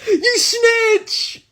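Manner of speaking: high, strained mock shout; hammed-up, high-pitched loud accusation; outraged classmates. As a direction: imitate a class yelling in outrage. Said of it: "everyone's like".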